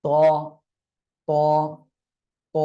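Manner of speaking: ত said with a thick, heavy sound. Shown as a demonstration. Teacher modelling heavy ত for students. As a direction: drill ত full and heavy, emphatic. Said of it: "অক্ষরটা একটু মোটা আওয়াজে হয়".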